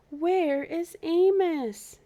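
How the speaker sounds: high, loud, slow calling out; worried animals. Acted. Zoo animals wondering where Amos is, high and slow. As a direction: call out the question, high and drawn out.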